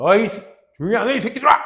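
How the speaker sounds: mock furious telling-off, high and loud; rude angry yell. Teacher out at a restaurant scolding noisy students, acted angry yell. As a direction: yell it like an irate adult at rowdy kids. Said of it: "이렇게 하면 안 되겠지".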